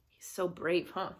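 ordinary delivery

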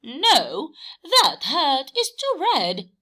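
high, loud refusal; Horse refusing. A narrator's reading. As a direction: turn the red hat down as Horse. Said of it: "said Horse".

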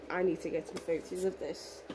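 ordinary delivery

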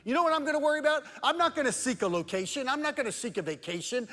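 high, loud sermon climax; preaching at full force. Acted. Pastor urging his church, preaching high and loud. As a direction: build the congregation up, full voice.

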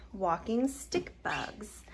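slow unvoiced whisper. Real, not acted. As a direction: breathe it out softly. Showing bugs, hushed voice.

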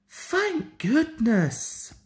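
slow sighing relief. A narrator's reading. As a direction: breathe it out slowly, relieved. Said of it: "sighed Thomas".

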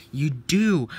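loud, insistent accusation; quarrelling retort. Acted. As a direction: fire it back loudly in a squabble. Quarrel, engines answer back.

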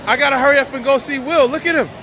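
ordinary talk, nothing marked

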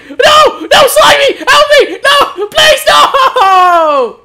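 high desperate screams; screaming. Real, not acted. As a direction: shriek for help. Dying in game, screams.

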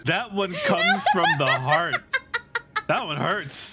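high, helpless laughter in the words; laughing. Real, not acted. Friend reacting to heartfelt insult, laughing. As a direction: squeeze it out high through laughter.

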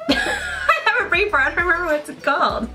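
high-pitched, laughing through the words; real laughing. Real, not acted. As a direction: crack up at your own forgetfulness. Laughing at her own forgetfulness.

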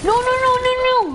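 rapid high-pitched string of refusals; panicked protest. Real, not acted. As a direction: blurt it out high in sudden alarm. Panics as he nearly gets killed.

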